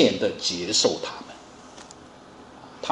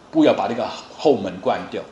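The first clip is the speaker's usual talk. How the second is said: ordinary delivery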